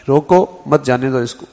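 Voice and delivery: "روکو" set apart, then "مت جانے دو اس کو"; break after روکو. Shown as a demonstration. Preacher showing how he meant the note, break after "روکو". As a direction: stop him: "روکو", then "مت جانے دو اس کو" as one phrase. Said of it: "کس انداز کی لکھی".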